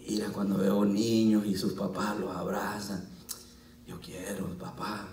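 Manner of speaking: whispered plea, soft and low; whispered prayer. Retold or imitated. Pastor whispering his longing for a child to God, softly.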